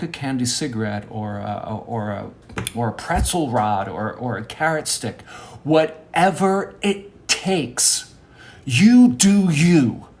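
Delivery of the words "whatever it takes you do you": high, drawn-out motivational cry; emphatic exhortation. Acted. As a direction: stretch each word like a rallying call.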